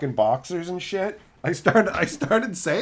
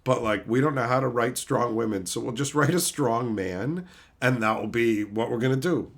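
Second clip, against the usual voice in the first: lower, dopey voice of clueless writers; a lightly lowered dopey voice; mock dumb writers; 7 semitones lower than usual. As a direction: play the writers as dim. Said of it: "they're like".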